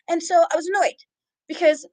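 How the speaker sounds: usual speaking voice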